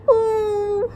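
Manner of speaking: high, wobbly scared ooh; trembling ooh. Acted. The kids hearing she's a real witch, wobbly scared ooh. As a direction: quiver like frightened children.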